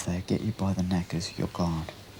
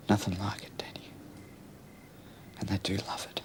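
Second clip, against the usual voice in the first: whisper; 2 semitones lower, 8 dB quieter than usual. Acted. Menacing confession to mate.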